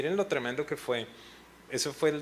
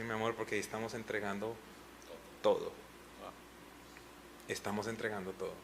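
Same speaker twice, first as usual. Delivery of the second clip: choked up; 2 semitones lower, 9 dB quieter, faster than usual. Retold or imitated. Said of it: "nosotros empezamos a llorar".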